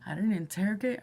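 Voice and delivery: usual speaking voice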